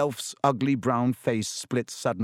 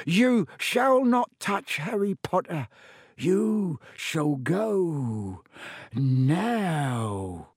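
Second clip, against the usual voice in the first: high, slowed elf voice stretching each word; a tiny elf standing his ground; fierce elf; 5 semitones higher, much slower than usual. A narrator's reading. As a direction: elf shields Harry, orders Malfoy away. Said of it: "he said fiercely".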